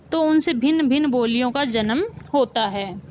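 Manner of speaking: ordinary talk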